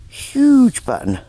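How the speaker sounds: huge drawn out high and loud; stress on huge. Real, not acted. Emphasising the find's size.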